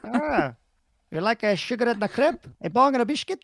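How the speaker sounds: put-on high, silly villain accent; Goldmember. Husband riffing on the film villain, high Goldmember accent. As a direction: offer it in a hammed-up Dutch accent. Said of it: "the guy from Goldmember".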